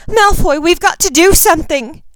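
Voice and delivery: loud, very high desperate cry; distraught girl shouting urgently; panicked plea. A narrator's reading. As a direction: beg for help in panic. Ginny begs Draco for help.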